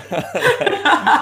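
loud, high burst of laughter; big real laugh. Real, not acted. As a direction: crack up at the punchline. Laughs at own joke.

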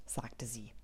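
whispered narration tag; a storyteller's hushed aside, whispered. A narrator's reading. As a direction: breathe the words without voice.

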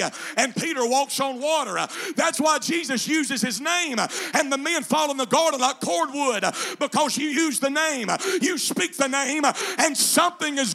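loud, high, chanted preaching; preaching at full force. Acted. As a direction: whoop it to the congregation. Preacher climaxes again.

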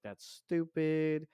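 slow, scornful brush-off; dismissive. Retold or imitated. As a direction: say it like it's obviously dumb. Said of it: "and I was like".

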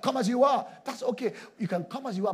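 loud, high mock invitation; mock open invitation. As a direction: call out the welcome mockingly. Mocking nightclub-style church invitation.

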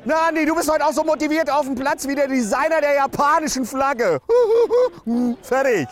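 shrill fan teasing player Andi; shrill heckler. Acted. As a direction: needle him from the stands.

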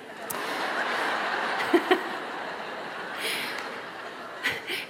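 drawn-out breathy laughter; long quiet laugh. Real, not acted. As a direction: laugh softly for a long while. Long laugh at her own remark.

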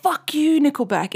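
high, hammed-up jeer at the band; mock angry fan. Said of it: "So they're like".

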